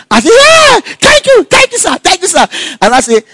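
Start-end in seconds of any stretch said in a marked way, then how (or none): 0.3-2.5 squealed thanks, much higher and louder; overjoyed thanks. Retold or imitated. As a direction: burst out with joy at passing. Jumped up at passing news.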